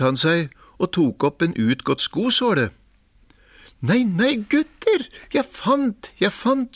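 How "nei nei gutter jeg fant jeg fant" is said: high, gleeful cry; excited call. A narrator's reading. Askeladden crying out his find to his brothers, high. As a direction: crow with delight. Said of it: "sa han".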